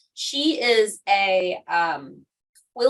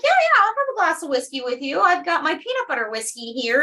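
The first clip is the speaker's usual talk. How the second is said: eager reply; 6 semitones higher, faster than usual. Retold or imitated. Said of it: "I was like".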